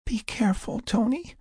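high, soft anxious hush; a hushed, high-pitched caution; whispered warning. A narrator's reading. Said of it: "Adia whispered".

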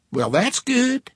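high, slow Texas waitress drawl; Texas accent. A narrator's reading. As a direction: stretch it out in a sweet Texas accent. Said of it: "in a Texas accent".

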